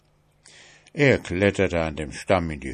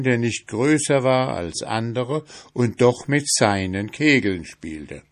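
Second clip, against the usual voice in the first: ordinary delivery, nothing marked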